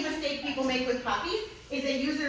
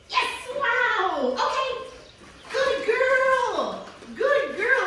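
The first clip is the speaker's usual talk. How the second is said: high, loud gushing praise; shower her with delighted praise; excited dog praise; 12 semitones higher, 4 dB louder, much slower than usual. Real, not acted. Trainer praising Gertie for going to place, high excited gushing.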